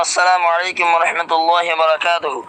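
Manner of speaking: ordinary talk, nothing marked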